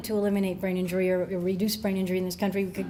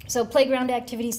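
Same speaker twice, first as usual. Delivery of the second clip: normal talking voice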